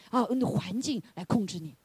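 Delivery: usual speaking voice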